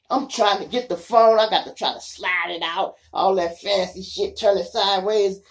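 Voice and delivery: loud, high, breathless chase story; frantic retelling. Retold or imitated. Recounting fleeing the police in a Geo Tracker, loud and frantic. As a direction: tell it like you're still in the car.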